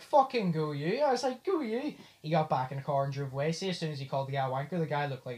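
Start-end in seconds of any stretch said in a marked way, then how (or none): 0.0-1.9 raised, higher cheer of approval; re-enacted whoop of support; retold cheer. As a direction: imitate egging someone on, pitch climbing. Cheering on the taxi driver's outburst.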